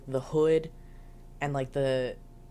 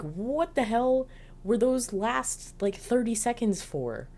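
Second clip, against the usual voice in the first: higher, slow, exasperated; mock exasperation; 8 semitones higher, slower than usual. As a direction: drag it out in disbelief. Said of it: "and I'm like".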